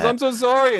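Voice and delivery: high, loud mock woman's voice, apologizing; imitating apologetic woman. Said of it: "And she goes".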